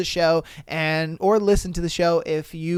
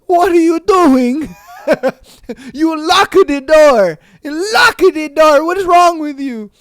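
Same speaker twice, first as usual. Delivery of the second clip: panicked Italian guy; 10 semitones higher, 8 dB louder than usual. Retold or imitated. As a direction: plead like the shocked Italian who walked in. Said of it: "Homeboy opened that door and was just like".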